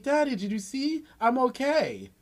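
put-on high-pitched, eager child voice; imitating an excited small child; proud little kid. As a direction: pipe up like a kid showing Daddy.

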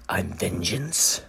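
slow, soft brooding hero growl; brooding Batman. Retold or imitated. Batman threatening the beaten thug, slow and brooding. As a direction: drag it out, dark and solemn. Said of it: "and then he's like".

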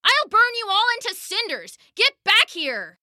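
high-pitched, furious scream; screaming in fury. A narrator's reading. Girl screams at the fleeing villains, furious shriek. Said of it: "she screamed".

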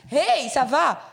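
greeting a passer-by, called loud and high. Retold or imitated. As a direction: call it out cheerfully. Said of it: "Ik zeg dat".

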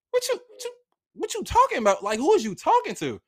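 high-pitched, incredulous comeback; retold indignant disbelief. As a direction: act out being affronted by the officer. Retells indignant comeback to cop.

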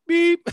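loud, very high beep; imitated phone beep. Prankster mimics his friend's phone key, high loud beep. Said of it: "Press one beep for yes".

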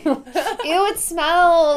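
grossed-out laughing ew; disgusted ew. Real, not acted. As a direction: recoil from the smell. Reacting to a fart smell.

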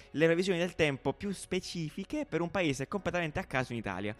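playful weather presenter voice; weather forecast read. Acted. Mock weather forecast segment.

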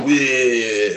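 drawn-out, playful "wee" as a cartoon flasher; hammed-up gleeful squeal of a creepy old man; mock flasher whoop. Speaker mimics a creepy old man in a trench coat, mock gleeful whoop. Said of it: "with a trench coat going".

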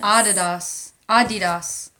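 Adidas as "AH-dee-das", front-stressed; pronunciation demo. Shown as a demonstration. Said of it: "How it's really pronounced?".

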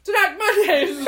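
yelled through a mouthful of snacks; mouth full yell. Acted. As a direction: shout it with food in mouth. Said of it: "Yell it again".

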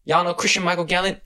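high-pitched retold question; imitated daughter. Daughter in the car asks the boys, imitated high voice. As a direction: ask it high, as the girl in the front seat.

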